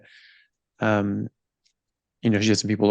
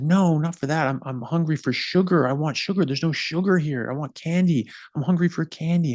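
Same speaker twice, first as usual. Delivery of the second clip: imitated kid's tantrum, pitched up; child's tantrum; 6 semitones higher than usual. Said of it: "throwing an absolute fit".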